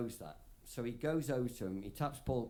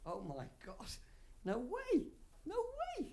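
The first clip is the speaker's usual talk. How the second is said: starstruck. Retold or imitated. Starstruck at meeting [name].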